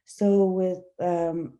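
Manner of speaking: ordinary talk, nothing marked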